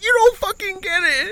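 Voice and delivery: very high mock-crying whine; hammed-up weepy protest; tearful protest. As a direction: squeal back as if sobbing. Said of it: "crying, whatever".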